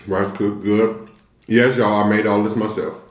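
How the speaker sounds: talking while chewing food; muffled speech between bites; mouth full chewing. Real, not acted. Seen 0: Took rice bite at -4; lips closed, chewing while talking.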